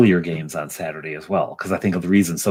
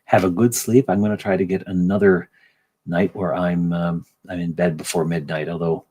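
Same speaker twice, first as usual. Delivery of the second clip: normal talking voice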